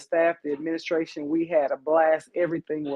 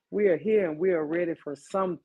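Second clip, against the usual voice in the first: usual speaking voice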